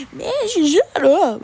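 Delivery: high, loud mocking squeak of the girl; mocking voice crack. Imitating the tuba girl to classmates with a mock voice crack. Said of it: "she has a voice crack every two seconds".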